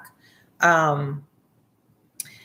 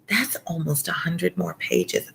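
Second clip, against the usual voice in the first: breathless whisper of dismay; 4 dB quieter than usual. Real, not acted. Reader sighing at the pages left, whispered. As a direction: whisper it like the pile is crushing you.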